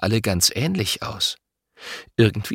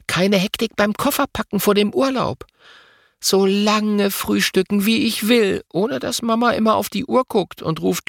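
put-on a girl's higher voice, dreamily wishing; wistful girl; 11 semitones higher than usual. A narrator's reading. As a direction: sigh the wish like a dreaming child. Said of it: "Lotta seufzt". (träumerisch seufzendes Mädchen)

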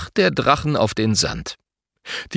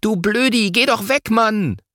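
put-on high-pitched cross child; storybook scolding without real volume; 11 semitones higher than usual. A narrator's reading. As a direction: tell him off in a high, annoyed pitch. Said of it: "Finn schimpft".

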